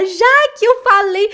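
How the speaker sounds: high, loud, slow proud cry; triumphant exclaim. Retold or imitated. Student thrilled she spoke German, high and loud. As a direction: burst out proud.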